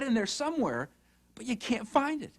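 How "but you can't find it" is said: ordinary delivery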